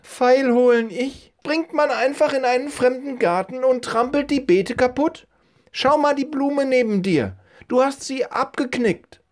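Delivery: put-on very high, scolding old lady voice; an old woman catching an intruder in her garden.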